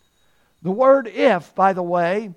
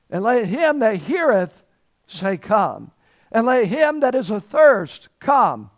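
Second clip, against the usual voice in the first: higher, louder proclamation; preaching scripture; 4 semitones higher, 6 dB louder than usual. Acted. Preacher proclaims the Bible's invitation to the thirsty, in full preaching voice. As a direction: ring out the invitation to come.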